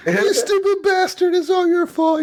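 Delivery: very high, drawn-out mock shriek; Abigail lashing out. Mother slapping John and blaming him, lashing out. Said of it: "she slaps the fuck out of John".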